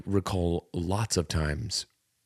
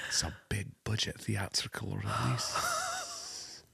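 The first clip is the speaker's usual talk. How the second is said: normal talking voice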